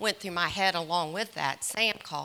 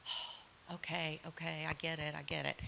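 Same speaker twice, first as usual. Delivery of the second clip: quiet concession; 11 dB quieter than usual. Retold or imitated. Mother conceding to grown son.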